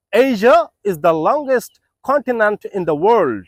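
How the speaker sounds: Asia is the longest continent in the world read very slowly; slow model sentence. Shown as a demonstration. Teacher dictating a model sentence slowly to learners. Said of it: "আপনি একটা sentence তৈরি করতে পারেন".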